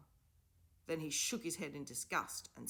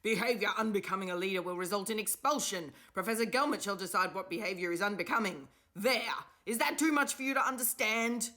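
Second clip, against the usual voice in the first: angry; 6 semitones higher, 8 dB louder than usual. A narrator's reading. Professor's furious tirade continues.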